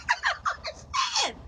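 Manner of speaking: very high laughing wail; exasperated squeal. Real, not acted. Trucker baffled by the wrong times, squealing exasperatedly. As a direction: squeal it in helpless disbelief.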